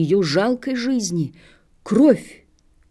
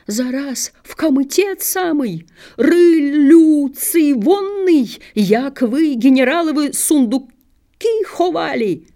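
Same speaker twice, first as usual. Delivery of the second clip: high, jeering angry tirade; enraged villager railing at a thief; furious accusation; 6 semitones higher than usual. A narrator's reading. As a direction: hurl the questions at her in a rising rage.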